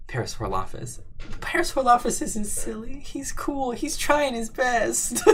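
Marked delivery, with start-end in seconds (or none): 1.4-5.2 very high, whiny, squeezed voice; mock-tearful defense. Streamer defends Parasaurolophus from chat, squeaky and mock tearful. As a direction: squeak it out as if about to cry, laughing at the end.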